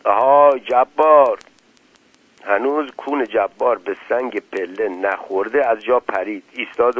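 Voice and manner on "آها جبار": loud, drawn-out summons; thick-voiced shout. A narrator's reading. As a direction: call out slowly in a thick, loud voice. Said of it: "صدای کلفت اسدالله میرغضب بلند شد. داد زد". (با صدای کلفت و کشیده داد بزن)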